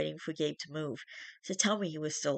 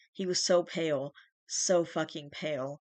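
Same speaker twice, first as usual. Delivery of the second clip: ordinary delivery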